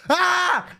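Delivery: high imitated scream; a quick shriek impression; a short high yelp of a scream; mock scream.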